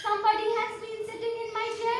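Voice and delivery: high, gentle mother bear voice; Mama Bear. A narrator's reading. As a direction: lift the pitch for a gentle storybook mama. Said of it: "said in her quiet, gentle voice".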